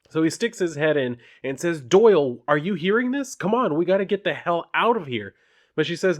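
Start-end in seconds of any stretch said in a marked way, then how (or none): 1.8-5.3 higher, louder urgent plea; urgent coworker. Acted. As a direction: hurry her out, voice pitched up. Coworker urging her to evacuate.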